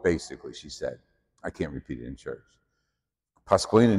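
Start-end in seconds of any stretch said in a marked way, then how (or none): none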